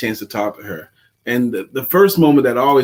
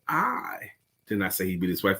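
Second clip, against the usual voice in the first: single out "I", the rest quieter; stress on I; 6 semitones lower, 11 dB quieter than usual. Shown as a demonstration. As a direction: lean hard on "I", then drop away. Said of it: "how you inflect it".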